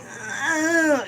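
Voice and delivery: sudden high yelp of pain; imitating a character's sharp cry. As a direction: cry out as he's hit. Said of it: "He's like".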